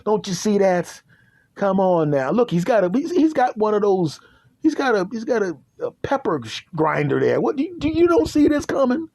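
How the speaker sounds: can't you see it, voice climbing; frantic disbelief. Retold or imitated. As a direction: pitch climbing to a panicked squeak. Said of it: "I'm like".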